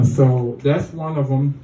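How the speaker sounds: normal talking voice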